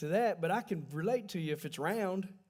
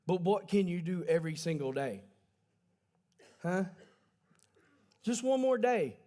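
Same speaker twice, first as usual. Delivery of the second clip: ordinary delivery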